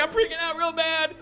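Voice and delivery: high, frantic panicked wail; panicking. Retold or imitated. Drugged passenger tells his tormentor he's panicking, frantic high wail. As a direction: squeal it out like he's losing his mind.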